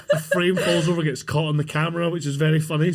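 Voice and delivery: normal talking voice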